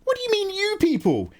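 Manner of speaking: high-pitched mock outrage; mock indignation. Streamer acting affronted at being called "you people", mock indignation. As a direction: squeal it in feigned offence.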